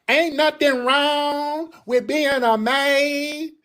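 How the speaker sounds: high, slow mock of a pandering politician; mocking AOC. Mocking AOC's pandering line in a high, slow voice. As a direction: drag it out like a politician playing folksy. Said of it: "they got her doing that".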